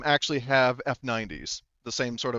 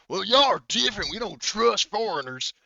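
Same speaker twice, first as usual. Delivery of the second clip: high, loud country voice; 8 semitones higher, 3 dB louder than usual. Acted. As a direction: hostile backwoods son, pitched up. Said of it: "one of his sons, uh, straight up says".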